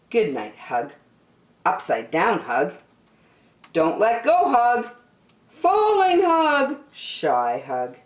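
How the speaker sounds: plain reading voice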